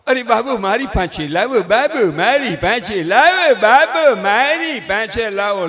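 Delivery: drawn-out, pleading mock husband; hammed-up doting father; fussing husband. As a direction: beg for the baby in stretched, put-on tones. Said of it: "તા તો ઈ બાઈનો ઘરવાળો".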